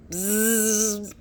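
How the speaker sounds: loud insect buzz; buzzing ants. Acted.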